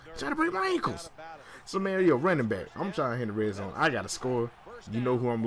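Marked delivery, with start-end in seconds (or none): 0.1-1.1 pitched-up protest about a dirty hit; high indignant whine. Real, not acted. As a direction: repeat it much higher, outraged. Repeats the complaint, much higher.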